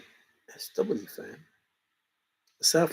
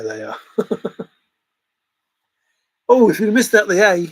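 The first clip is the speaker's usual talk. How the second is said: ordinary talk, nothing marked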